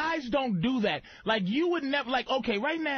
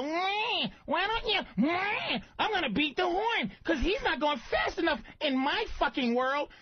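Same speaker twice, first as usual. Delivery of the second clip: high, nagging mock-woman whine; mocking whiny woman; 5 semitones higher than usual. Comedian mocking an entitled woman driver, high whiny voice. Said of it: "They go".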